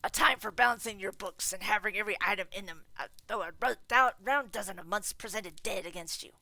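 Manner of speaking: loud, bitter tirade; ranting miser. Acted. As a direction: rail against the season. Scrooge ranting on.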